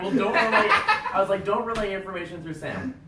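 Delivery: a half-laughing mock reprimand; mock scolding.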